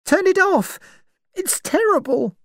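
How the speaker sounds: put-on young woman crying out in horror; frightened cry. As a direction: beg them to stop it. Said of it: "Vera cried".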